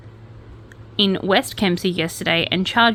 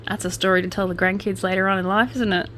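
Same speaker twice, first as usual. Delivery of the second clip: normal talking voice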